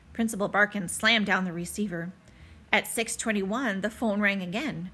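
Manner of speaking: plain reading voice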